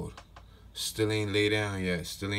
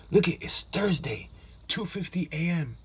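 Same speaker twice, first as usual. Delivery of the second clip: normal talking voice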